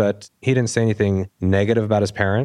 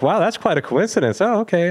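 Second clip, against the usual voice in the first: much higher voice for the siblings; mock polite amazement; 9 semitones higher than usual. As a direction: imitate relatives reacting to a coincidence. Siblings' reaction, acted out.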